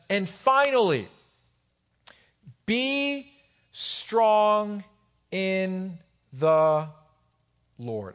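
word by word, with long pauses; slow emphatic reading. Real, not acted. Preacher reading Paul's letter to the church, slow emphatic reading.

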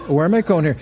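usual speaking voice

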